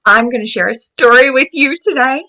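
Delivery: ordinary delivery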